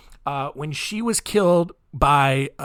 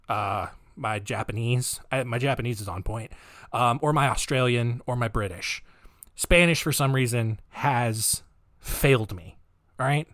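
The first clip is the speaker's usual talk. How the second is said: ordinary talk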